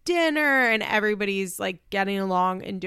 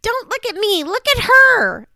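put-on shouting child; 13 semitones higher, 11 dB louder than usual. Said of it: "she goes".

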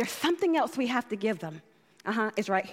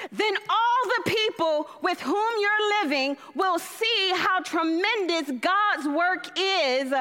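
rising scripture reading; 5 semitones higher, slower than usual. Acted. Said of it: "what it says in the Message".

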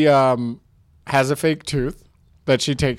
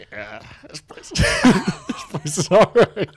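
gruff drawl; 4 semitones higher, much slower than usual. Acted. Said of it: "and she's just like".

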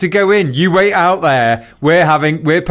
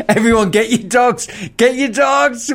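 higher mock evacuation call; Titanic-style alarm; 7 semitones higher than usual. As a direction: shout it like abandoning ship. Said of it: "said it was like the Titanic".

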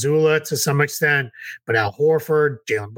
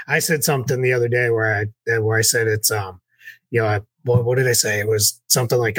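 usual speaking voice

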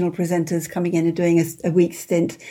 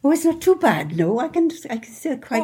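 a lilting Pembrokeshire Welsh accent, pitched higher; a higher, singsong Welsh accent; 8 semitones higher than usual. Shown as a demonstration. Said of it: "How's, how's your Welsh accent".

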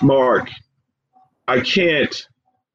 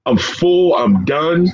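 choked up; slower than usual. Real, not acted. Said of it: "I'm so emotional right now".

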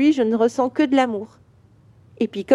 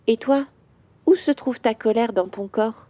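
calm questions with long pauses, as a gentle father; soothing guided visualisation. Acted. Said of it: "Je vais te parler comme me parle mon papa".

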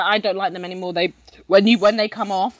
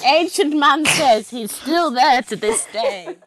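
put-on laughing old man voice; 6 semitones higher, slower than usual. Laughing while playing the old man.

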